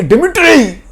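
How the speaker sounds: high, loud scream calling Dmitri; a woman's panicked cry from another room; screaming name. Acted. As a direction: shriek his name from the next room. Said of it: "उसके चीखने की आवाज़ आती है".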